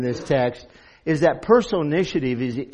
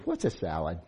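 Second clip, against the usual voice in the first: low, soft, hesitant question; small, unsure, dropped-voice question; 10 semitones lower, 12 dB quieter than usual. A narrator's reading. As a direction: ask it timidly under your breath. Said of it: "Eve hesitated".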